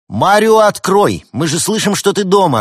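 high, loud demanding call; shout from outside. A narrator's reading. Visitors call to Марио through the door, shouting. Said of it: "раздался крик из-за двери".

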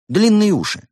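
high, loud, emphatic proposal; enthusiastic. A narrator's reading. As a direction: push the idea proudly. Rabbit proudly proposes long ears.

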